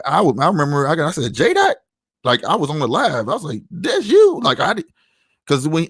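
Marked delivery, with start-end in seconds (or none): 1.3-1.8 high, incredulous questioning of a name; retold surprise. Recognizing him, did not know him before. 3.7-4.4 high-pitched amazed realization; retold amazed recognition. Retelling recognizing him on the live.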